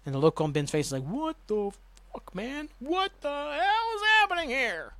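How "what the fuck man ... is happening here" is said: high, slow strained voice; shocked disbelief. Retold or imitated. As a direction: gape at the body and squeak out the shock. Ben's face at Locke's body.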